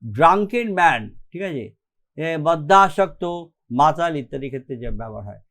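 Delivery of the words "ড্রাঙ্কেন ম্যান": ordinary talk, nothing marked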